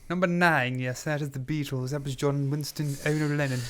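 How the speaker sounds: put-on posh English, plummy boxer-meets-actor voice; theatrical posh English accent; posh English gentleman accent. Shown as a demonstration. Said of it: "in this posh English gentleman a-, accent".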